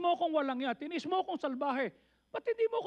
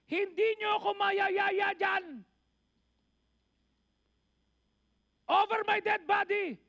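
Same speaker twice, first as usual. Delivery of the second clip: loud, slow, defiant shout; defiant refusal; 5 semitones higher, 4 dB louder, slower than usual. Retold or imitated. Preacher retelling a friend's refusal to the congregation, slow defiant shout. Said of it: "sabi niya".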